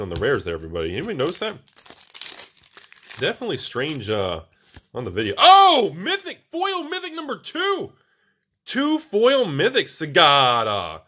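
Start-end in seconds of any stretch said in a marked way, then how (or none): 5.4-9.9 much higher, loud thrilled cry; excited shout. Real, not acted. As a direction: shout the big pull. Pulls second foil mythic.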